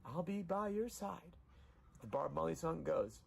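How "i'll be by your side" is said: grieving plea. Real, not acted. Emotional vow to dead dog.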